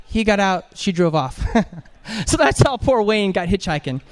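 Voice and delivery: normal talking voice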